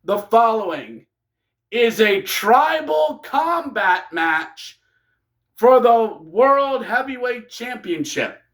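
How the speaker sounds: high, drawn-out mock announcer call; imitated ceremonial fight introduction; ring announcer. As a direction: announce the bout to a whole stadium. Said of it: "during the introduction, we go, we hear".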